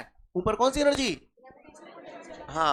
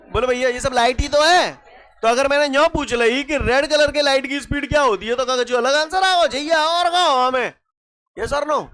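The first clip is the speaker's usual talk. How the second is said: raised, high-pitched teacher rant; loud, emphatic harangue, pitched up; loud challenge; 5 semitones higher, 8 dB louder than usual. Real, not acted. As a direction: challenge the class at full volume. Mock challenge over trick question.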